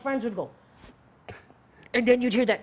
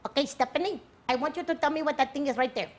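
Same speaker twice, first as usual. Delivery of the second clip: put-on higher, fast Filipino-accented teacher; lightly voiced Filipino sub calling on a student; the Filipino sub, playful Filipino accent; imitating Filipino teacher; 4 semitones higher, faster than usual. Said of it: "she'd go like this".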